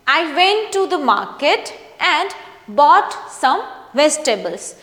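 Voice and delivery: slow, clear read with a falling end at the full stop; reading with proper intonation. Shown as a demonstration. Teacher reading a paragraph aloud for students, slow with clear intonation. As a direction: read it slowly, letting the voice drop at the full stop. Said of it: "proper intonation का use करके".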